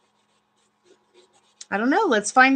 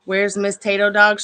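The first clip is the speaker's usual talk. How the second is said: normal talking voice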